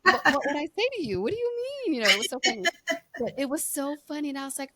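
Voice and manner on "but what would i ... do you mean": higher, louder playful protest; mock indignation. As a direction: pretend to be baffled and offended. Mock indignant reply to friend.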